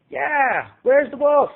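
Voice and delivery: very high, loud jeering voice; shrill heckler. A narrator's reading. Second heckler joining in, high loud jeer. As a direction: needle him from the crowd. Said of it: "someone else harped".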